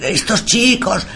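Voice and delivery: high, anguished impression; high-pitched, lamenting cry; mock guilt-stricken wail. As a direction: imitate a soul tormented by guilt. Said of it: "un sentimiento de culpa terrible".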